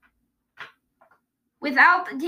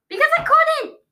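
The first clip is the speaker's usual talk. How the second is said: high, loud protest; exasperated shout; 12 semitones higher, 6 dB louder than usual. Real, not acted. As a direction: shout it in frustration. Protesting he could not talk.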